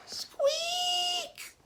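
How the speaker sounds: very high shrill squeak; high mouse squeak. Acted. The mouse squeaking to Owl, high and piercing.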